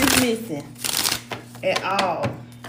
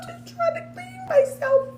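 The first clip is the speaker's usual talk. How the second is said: high whiny complaint. Retold or imitated. Complaining about husband intruding.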